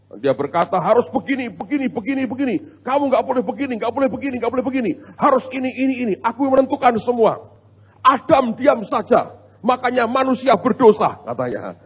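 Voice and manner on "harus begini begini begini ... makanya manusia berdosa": high, insistent mock tirade; husband lecturing his wife. Husband laying down rules to his wife, mock hectoring. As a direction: reel off rules like a preaching husband, pitched up. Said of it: "dia ngotbahi istrinya".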